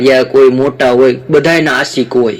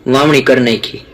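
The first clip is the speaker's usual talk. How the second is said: usual speaking voice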